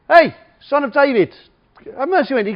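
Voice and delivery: high, loud call over a crowd; shouting out. Acted. Blind man calling to Jesus from the crowd, shouting out. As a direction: shout out to get his attention. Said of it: "starts shouting out".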